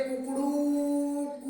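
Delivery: mock rooster call with कू stretched long; rooster crow. Shown as a demonstration. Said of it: "यानी लंबा". (मुर्गे की बांग की नकल)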